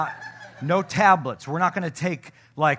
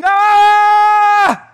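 very high, loud sustained scream; full-throated mock scream of childbirth; imitated labor scream; 16 dB louder than usual. Husband imitating his wife giving birth, loud scream. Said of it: "She's screaming".

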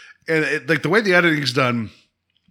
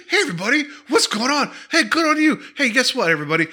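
high, cheery fake-friendly boss; hammed-up cheerful farewell to the crew; upbeat mood; 3 semitones higher, faster than usual. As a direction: beam at everybody like a boss in a great mood. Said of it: "in an upbeat mood".

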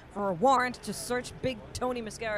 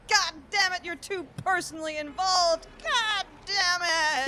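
higher, melodramatic cop outburst; overacted frustration; 8 semitones higher than usual. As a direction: ham up the exasperation.

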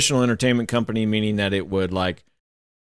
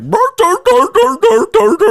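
much higher, much louder dopey babble; mock dumb voice; 10 dB louder than usual. Retelling how his wife mocks him, loud high dopey babble. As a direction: bray like a goofy dimwit. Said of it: "he's all like".

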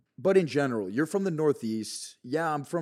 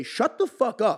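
mock shout at himself; 10 semitones higher, 4 dB louder, slower than usual. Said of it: "I'll audibly say".

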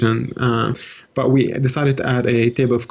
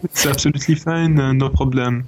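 French accent. Shown as a demonstration. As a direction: put on a French accent for show. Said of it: "I'll do the French one".

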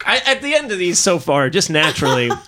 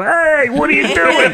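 higher, louder, laughing sitcom-dad voice; Ray Romano voice; 8 semitones higher, 3 dB louder than usual. Shown as a demonstration. Showing friends the Ray Romano voice he always had. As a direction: toss it off like a whiny sitcom dad. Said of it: "It's like Ray Romano".

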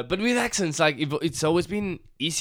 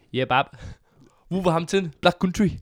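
louder mock Wolverhampton accent; 4 semitones higher, 6 dB louder than usual. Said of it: "I pick up the accents. When I went to Wolverhampton".

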